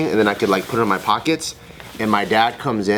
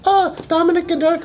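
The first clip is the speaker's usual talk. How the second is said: very high-pitched imitation of a child; little boy. Son answering parent in the car, high innocent child. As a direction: innocent small-child voice, put on.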